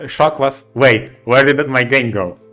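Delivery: usual speaking voice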